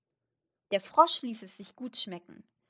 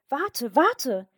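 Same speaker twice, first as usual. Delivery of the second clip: high, loud cry of a frog calling after someone; 5 semitones higher, 8 dB louder than usual. A narrator's reading. Frog calls after the fleeing princess, calling out loudly. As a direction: call out urgently, pitched up and louder. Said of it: "rief der Frosch".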